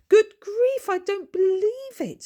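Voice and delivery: overjoyed disbelief; ecstatic surprise. A narrator's reading. Lady Ludroth sees her sister arrive, shrieking high with delight.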